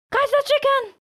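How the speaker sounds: very high shrieking call; shout. A narrator's reading. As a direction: squeal it out to the yard. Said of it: "shouts Auntie Aisha".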